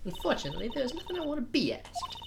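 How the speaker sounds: high, haughty put-on refusal; affected Miss May voice. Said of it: "gotta get into this voice".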